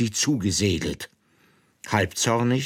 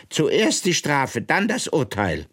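queen's command; 7 semitones higher than usual. Acted. Queen dictates court order.

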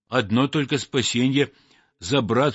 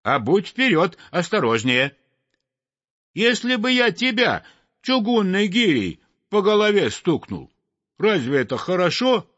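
scolding; 7 semitones higher than usual. Acted. Sparrow keeps scolding the sweep.

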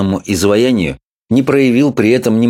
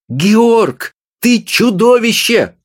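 much higher, outraged yell at her husband; shrill scolding cry; 13 semitones higher, much slower than usual. A narrator's reading. Wife crying out at her husband, outraged. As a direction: screech it at him in outrage. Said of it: "закричала она".

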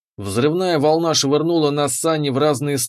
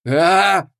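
sharp cry of agony; pained scream; 5 semitones higher, 4 dB louder than usual. Acted. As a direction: scream as the shock hits. Hero screams from electric shock. (крик боли)

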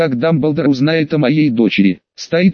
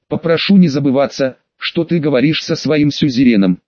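ordinary delivery

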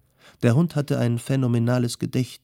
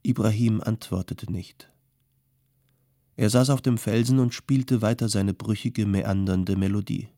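plain reading voice

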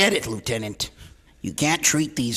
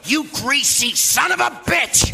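furious yell, much higher and louder; furious shout; 5 dB louder than usual. Acted. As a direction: roar it in a rage. Cop beating suspect.

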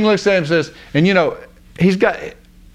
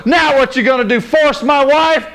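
high-pitched, hammed-up fury; outraged accusation; 9 semitones higher than usual. King catching Haman near his wife, outraged. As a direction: imitate a livid husband confronting a villain.

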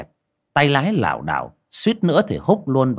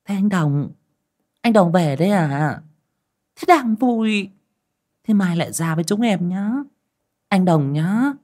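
put-on much higher, wheedling bar-girl voice; coquettish girls; 8 semitones higher than usual. A narrator's reading. Bar girls pleading with their customer to return, high and wheedling. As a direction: coax him to come back tomorrow. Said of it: "nhấm nhặng".

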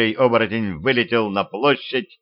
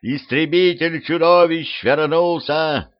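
high, slow announcing call; boy shouting; 6 semitones higher, slower than usual. A narrator's reading. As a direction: shout the news across the square. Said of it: "закричал пацан с крыши таверны".

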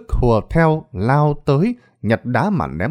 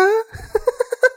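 madwoman's high giggling cackle; cackling laugh. A narrator's reading. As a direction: cackle like a crazed woman. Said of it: "cười lên khanh khách". (cười khanh khách điên dại)

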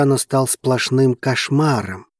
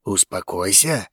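low, subdued menacing growl; 5 semitones lower, 5 dB quieter than usual. A narrator's reading. Zein to the friend blocking him, low growl. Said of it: "зарычал Зейн, желая убить его".

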